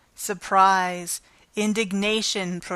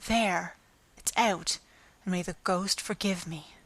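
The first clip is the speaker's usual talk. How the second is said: under her breath; 6 dB quieter than usual. A narrator's reading. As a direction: murmur it to yourself. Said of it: "under her voice".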